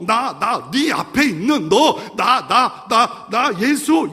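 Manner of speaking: loud, high, slow insistent preaching; fervent pulpit proclamation; preaching at full force. Acted. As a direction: hammer home the name of Jesus. Preaching climax.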